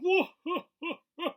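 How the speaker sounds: loud, high booming belly laugh; a big hearty guffaw from down the hall; boisterous laugh. Acted. Teacher laughing with colleagues, loud boisterous laugh. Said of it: "Mister Sphincter's boisterous laugh".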